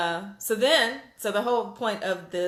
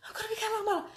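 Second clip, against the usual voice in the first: high, bright announcement; thrilled announcement; 5 dB quieter, much faster than usual. Retold or imitated. Telling her daughter she's a catalog model, thrilled. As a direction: burst out the big news. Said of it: "I was like".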